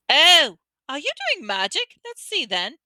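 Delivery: put-on high, eager bossy girl; girl demanding to watch the spell. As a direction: push in, keen to see magic. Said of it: "a very bossy sort of voice".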